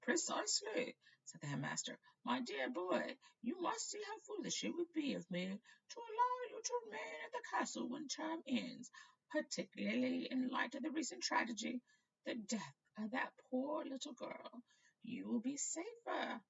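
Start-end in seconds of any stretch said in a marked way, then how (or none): 0.0-1.0 put-on much higher frail old voice; old headmaster. Dippet agreeing with Riddle, frail and high. 2.2-16.4 put-on much higher frail old wizard; old headmaster. Dippet sending Riddle home, frail and high.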